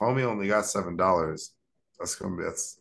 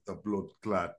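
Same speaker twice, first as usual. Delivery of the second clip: mock Jamaican rude boy; 6 dB quieter than usual. Castaway cursing at his rescuers in mock Jamaican patois. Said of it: "rude boy Jamaican".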